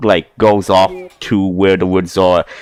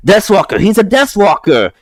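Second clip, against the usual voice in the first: much higher, excited orc cry of Deathwalker; alarmed orc; 12 semitones higher than usual. Acted. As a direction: raise the alarm like a panicky orc. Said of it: "he is bringing a Goliath and says".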